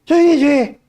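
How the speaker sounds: put-on high, whiny irritated teenager; annoyed daughter. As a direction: grumble like a sulky kid woken up. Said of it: "이러고 입을 푹 뒤집어 쓰고".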